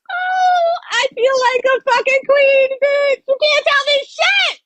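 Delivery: very high, excited delighted voice; high squealing joy over the feast cooked for her; giddy squealing. Real, not acted. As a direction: gush like a thrilled queen. Celebrating the feast.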